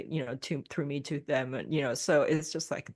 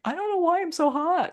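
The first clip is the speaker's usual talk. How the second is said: exasperated whine; 14 semitones higher, 6 dB louder than usual. Retold or imitated. Exasperated person in heat quote.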